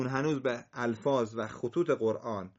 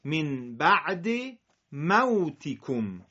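من بعد موتکم slow and deliberate; slow model reading; much slower than usual. Shown as a demonstration. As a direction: read موتکم syllable by syllable. (آهسته و شمرده)

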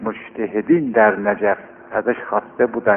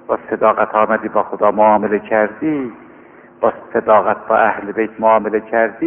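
ordinary talk, nothing marked

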